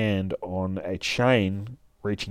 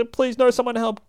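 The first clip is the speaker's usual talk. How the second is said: very high panicked cry for help; high terrified plea; 4 dB louder than usual. Acted. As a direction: blonde being dragged away by the hair, high and terrified. Victim dragged by hair.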